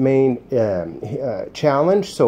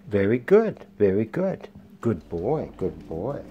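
soft dog praise; 5 semitones lower, 6 dB quieter than usual. Real, not acted. Praising the dog after the recall, low and soft. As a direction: lower and softer, gentle praise.

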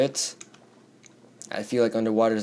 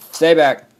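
loud, higher warning yell; warning shout; 5 semitones higher, 10 dB louder than usual. Real, not acted. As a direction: shout the attacker away. Warding off an attacker, louder.

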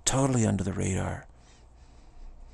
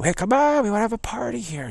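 put-on squealy party girl, much higher and louder; mock party girl; 13 semitones higher, 3 dB louder than usual. Party girls coaxing a shy woman along, mock high and squealy. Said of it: "pass you by and say".

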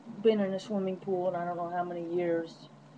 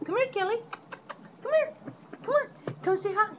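calling the dog; 13 semitones higher, faster than usual. Real, not acted. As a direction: coax the dog over, pitched way up.

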